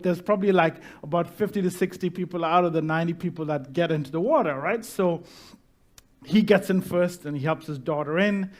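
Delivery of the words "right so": usual speaking voice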